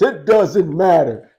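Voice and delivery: loud, high, drawn-out wrestler catchphrase; The Rock. Acted. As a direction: boom it out slowly like a showboating wrestler. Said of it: "it's the Rock voice".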